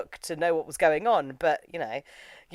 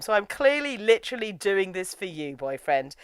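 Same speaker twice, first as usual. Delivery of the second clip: ordinary talk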